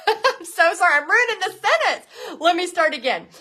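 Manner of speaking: real laughter breaking into the sorry; laughing apology. Real, not acted. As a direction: giggle out the apology after flubbing the line. Laughing at her own misreading.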